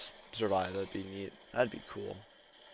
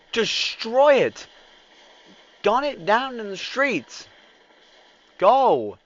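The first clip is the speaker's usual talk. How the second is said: battle shout; 13 semitones higher, 13 dB louder, slower than usual. Pretend. Ordering attack.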